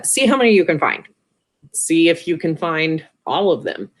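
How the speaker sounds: ordinary talk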